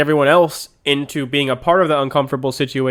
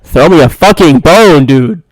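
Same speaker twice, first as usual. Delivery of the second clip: loud, pleading outburst; exasperated shout; 12 dB louder than usual. Real, not acted. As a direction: yell it like you're begging for help. Partner leaves him hanging on air.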